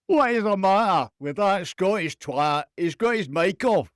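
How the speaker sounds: loud, put-on Scottish accent; hammed-up exasperated Scot; impersonating Scottish co-host. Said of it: "I'll have to be him".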